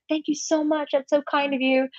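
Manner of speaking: high, gushing mock gratitude; hammed-up, high-pitched effusive thank-you; over-the-top American. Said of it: "we're very over the top".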